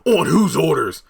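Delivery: loud, high mock outrage; imitated outraged shout. Vlogger quoting Kyle being taken to a cell, outraged shout. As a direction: shout it like an indignant prisoner.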